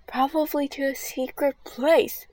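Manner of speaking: excited. Acted. Andy excited about discovery.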